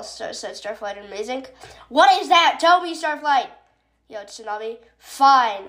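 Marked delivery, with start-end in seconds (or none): 1.9-3.5 demanding question. Acted. Tsunami demands answers.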